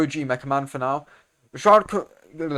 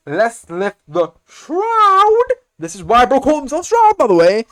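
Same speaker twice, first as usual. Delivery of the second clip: very high, much louder, drawn out; very high, much louder thrilled fight commentary; hyped shout; 9 semitones higher, 10 dB louder, slower than usual. Real, not acted. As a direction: belt out the big line in awe. Climactic quote shouted hyped.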